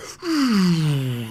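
high descending machine whirr dying away; powering-down noise. Retold or imitated. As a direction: imitate equipment powering down with a long falling whoosh. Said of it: "like, powering down noises".

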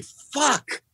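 high, panicked swearing; terrified curse. Retold or imitated. Comic recalling stage fright, panicked high swear. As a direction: blurt it out as terror hits. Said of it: "overwhelmed with terror".

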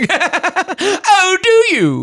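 high, loud mock-coy question through laughter; laughing, squeal it way up high; over-the-top teasing comeback; hammed-up reply. Hammed-up replay of flirty reply.

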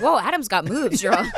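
high, amused lady voice; mock lady neighbour. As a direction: tease like an impressed auntie. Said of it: "They're like".

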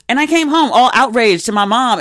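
normal talking voice

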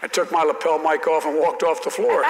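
ordinary talk

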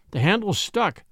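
usual speaking voice

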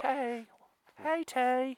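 high, light sing-song hello to Tay; high greeting. Pretend. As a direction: greet her brightly, voice up high. Greeting the girl named Tay.

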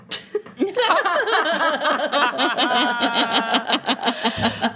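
long burst of real laughter at the punchline; laughing hard after the punchline; big real laugh. Real, not acted. Cracking up at her friend's joke, big laugh.

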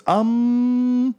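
high-pitched, hesitant talking shield; high little object voice, umming and agreeing. Acted. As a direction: voice a shield musing aloud, pitched well up. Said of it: "The shield goes".

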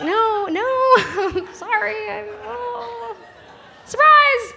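high, giggling awkward confession; embarrassed laughing. Retold or imitated. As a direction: laugh it off with a sheepish surprise. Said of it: "I'm like".

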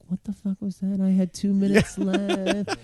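high, strained-sounding disbelief; hammed-up high-pitched complaint; mock exasperation. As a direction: wail it in exaggerated frustration, slowing at the end. Acted exasperation after the heckler.

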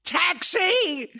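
high, loud hailing shout; acted street call; calling a taxi. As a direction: yell out for a cab, far up in pitch. Wife hailing a cab in story.

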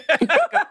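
a sudden high, awkward laugh; embarrassed high laugh. Real, not acted. Guest laughing to avoid crying on air, high awkward laugh. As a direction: laugh it off to hide the tears.

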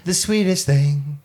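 put-on high, slow drunken drawl; sloppy drunk voice. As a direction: slur it like a tipsy friend. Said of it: "in a drunken voice".

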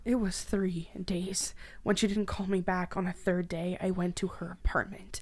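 remember it through grief; grieving whisper. Acted. Woman recalling finding her dead sister, whispered.

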